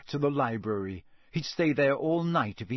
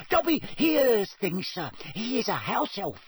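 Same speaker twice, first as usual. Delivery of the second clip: high, squeaky, earnest house-elf; Dobby; 9 semitones higher than usual. A narrator's reading. Elf assuring Harry he is sure, squeaky and earnest. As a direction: squeak it in complete sincerity. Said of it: "said the elf earnestly".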